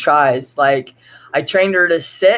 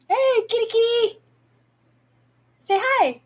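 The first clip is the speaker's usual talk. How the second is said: much higher cooing call to a cat; pet talk. Real, not acted. Owner calling her cat over, high pet-talk voice. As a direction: coax the kitty in a sing-song voice.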